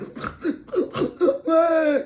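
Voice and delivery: high laughing wail; laugh-cry. Real, not acted. Reader laughing at the ugly cry she sees. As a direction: laugh until it nearly breaks into crying.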